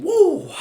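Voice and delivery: high, loud woo; sharp high-pitched holler; excited whoop. Acted. Whoop during hyped-up monologue.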